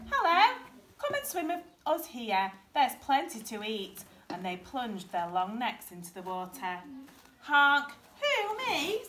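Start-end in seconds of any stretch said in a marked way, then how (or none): 0.1-4.0 high, sing-out greeting easing down; friendly storybook swans inviting; welcoming birds. A narrator's reading. 7.4-9.0 loud honk, high incredulous question; storybook duckling taken aback; surprised duckling. A narrator's reading. Duckling startled by invitation.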